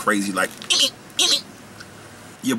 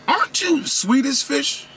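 high, slow, wide-eyed fanboy question; starstruck Trident voice; 11 semitones higher than usual. Acted. Trident meets Swedish Fish at a coffee shop, high starstruck voice. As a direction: play the gum brand meeting a star. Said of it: "They sat down next to each other and was like".

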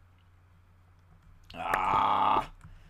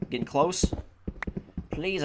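ordinary delivery

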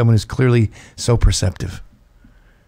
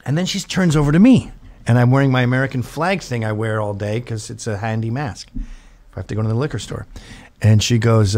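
usual speaking voice

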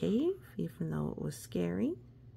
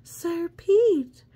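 high, loud call of the name; lady calling to the knight; Lady Callie calling; 12 semitones higher, 11 dB louder than usual. Acted. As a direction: call out to him. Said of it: "says Lady Callie".

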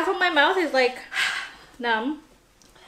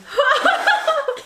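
high-pitched laugh; 5 dB louder than usual. Real, not acted.

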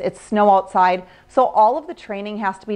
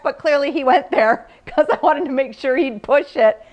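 ordinary talk, nothing marked

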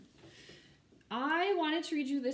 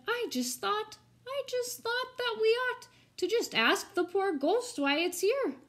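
put-on much higher, timid little boy's voice; small voice; 11 semitones higher, 4 dB louder than usual. Young Herbert timidly speaking up at the town meeting, small high voice. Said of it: "a small voice did puncture".

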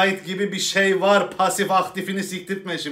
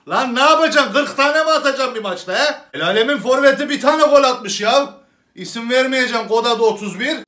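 louder, higher angry rant; mock rant; 6 semitones higher, 7 dB louder than usual. Comic rants at the strikers in a loud mock outrage. Comic ranting loudly at team.

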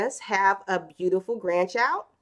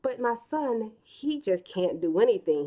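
normal talking voice

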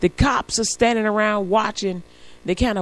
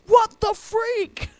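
high, slow mock yell; drivers echoing outrage; 12 semitones higher, 4 dB louder, much slower than usual. All the drivers joining the cop, drawn-out mock outrage. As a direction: echo the outrage, slightly less shrieking. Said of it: "And we're all like".

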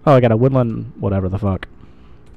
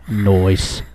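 normal talking voice

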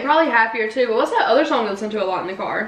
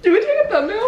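laughing; 10 semitones higher, faster than usual. Real, not acted. Laughing question to friend.

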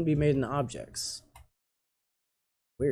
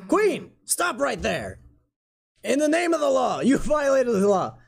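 high, ringing command to halt; heroic challenge; 9 semitones higher, 3 dB louder than usual. Acted. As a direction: call out the villain in the name of the law. Acting out confrontation with the Queen.

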